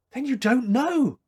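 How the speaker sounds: high, breathless shock; gasped. Acted. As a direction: gasp it out in disbelief. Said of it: "she gasped".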